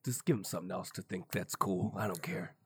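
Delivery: low, hushed, dismissive mutter; offhand aside. Acted. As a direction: toss it off low and under your breath. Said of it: "'cause you're just like, "Hey".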